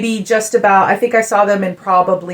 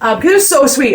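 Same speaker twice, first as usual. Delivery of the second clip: ordinary delivery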